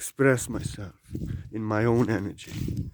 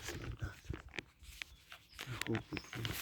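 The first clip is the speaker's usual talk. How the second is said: whisper; 3 semitones lower, 24 dB quieter than usual. Real, not acted. Fading to a whisper.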